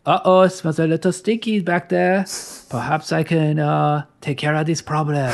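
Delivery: lightly hammed French accent; Gerard Depardieu French accent. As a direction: keep the playful French accent going. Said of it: "Your French accent is getting better".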